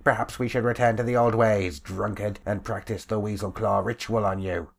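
a bully mocking his victim; sneering bluecoat leader. Bluecoat leader taunts the beaten old man, sneering loudly.

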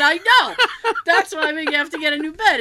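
worked-up agreement; exasperated. Retold or imitated. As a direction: throw it back at mom loudly. Retold exasperated reply to mom.

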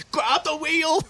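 high urgent yell, retold; panicked cry. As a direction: yell it like he's panicking on a bridge. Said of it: "and he goes".

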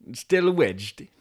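Yorkshire delivery driver. Acted. Driver checking on wedged Toby, Yorkshire accent. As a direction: ask it in a put-on Yorkshire accent. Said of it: "it'll be another Yorkshire".

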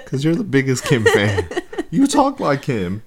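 loud, high-pitched teasing with a laugh; laughing tease. Real, not acted. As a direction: tease your co-host loudly, laughing. Teasing co-host through laughter.

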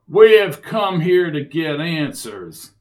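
loud, slow demand; forceful. Acted. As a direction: lay down the law, slowly. Sid confronts the mayor.

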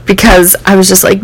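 normal talking voice

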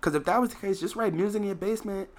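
a hint of [name]; quoting [name]. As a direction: relay his advice in a light mock voice. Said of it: "[name] was like".

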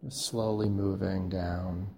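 slow, soft body-scan voice with long pauses; guided meditation. Acted. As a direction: guide them gently through the body, softly and unhurriedly.

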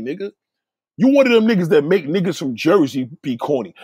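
ordinary talk, nothing marked

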